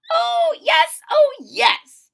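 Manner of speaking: very high and loud, gushing; eager squeal. Acted. Pupil reassures her doubtful teacher, high and gushing. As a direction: squeal it with overexcited confidence.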